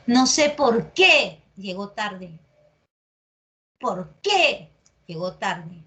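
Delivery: slow, heavy stress on qué; over-pronounced teaching demo; exaggerated emphasis. Shown as a demonstration. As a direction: drag out "por qué" with weight on qué. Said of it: "está exagerando la pronunciación".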